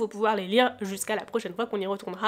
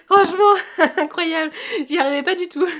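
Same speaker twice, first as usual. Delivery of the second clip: laughing; 6 semitones higher, 7 dB louder than usual. Real, not acted. Laughing after finally opening box.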